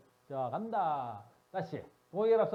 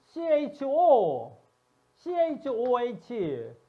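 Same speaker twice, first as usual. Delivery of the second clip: loud, high, very slow letter by letter; slow emphatic spelling; 7 semitones higher, 9 dB louder, much slower than usual. Shown as a demonstration. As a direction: hammer out each letter of the formula. Reading formulas aloud to class.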